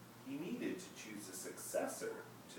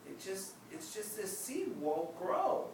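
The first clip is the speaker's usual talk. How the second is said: put-on higher, slower child's voice; a small boy defending himself, slowly; Ping's voice; 11 semitones higher, slower than usual. Ping answers his friend, a hurt child voice. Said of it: "Ping said".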